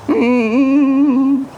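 loud squeaky hum for tomato; loud high hum. Shown as a demonstration. For the stranger, a loud high soup hum. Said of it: "see my cream of tomato".

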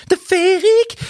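hammed-up fairy-tale wonder; mock dreamy gush. Gushing over the band's sound.

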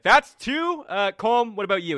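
high, loud eager count; Turi voiced. Game master as Turi eagerly counting votes, high and loud. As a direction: chirp the tally.

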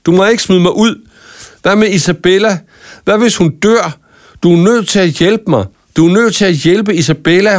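højere, bedende råb; råb for at få hende til at hjælpe. A narrator's reading. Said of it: "råbte Marco". (desperat, højere råb om hjælp)

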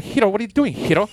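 high mimicry, accented "Hiro"; imitated girl character. Teasing how she says Hiro's name, high mock girl voice. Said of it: "when she mentions the character hero's name".

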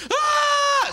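high, imitated scream of a wife. Husband imitating his wife screaming in bed, screaming. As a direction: shriek as if startled from sleep. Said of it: "my wife starts screaming".

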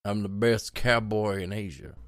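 slow cowboy impression; unhurried film-cowboy voice; John Wayne. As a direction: play a movie cowboy, taking it slowly. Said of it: "He always used to talk like this".

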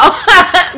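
loud laughing burst. Real, not acted. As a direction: laugh hard. Laughing at the impression.

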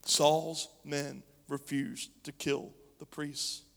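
usual speaking voice

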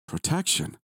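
high, incredulous rising question; disbelief. A narrator's reading. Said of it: "Chris said in disbelief".

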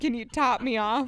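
laughing through a TikTok line; giggling as she repeats the line; laughing quote. Retold or imitated. Friend quoting a TikTok to her co-host, laughing. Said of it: "Have you seen that TikTok?".

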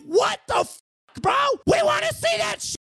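high, loud indignant yell; outraged shout. Retold or imitated. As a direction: shout it out in disbelief. Outraged at skipped wedding scene.

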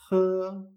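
J as a gentle, sustained h sound; very soft J. Shown as a demonstration. Teacher showing the Chinese H, J softened and held. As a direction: breathe out the J lightly, without scraping. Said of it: "pero muy suave".

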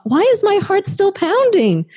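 high-pitched exasperated self-questioning. Retold or imitated. Speaker recalls day seventy-five of trying, exasperated. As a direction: demand an answer from yourself, pitch way up. Acts out her frustrated thought.

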